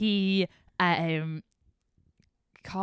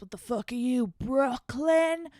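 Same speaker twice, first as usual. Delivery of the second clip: put-on drunk ex voice; 6 semitones higher, 4 dB louder, slower than usual. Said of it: "And he goes".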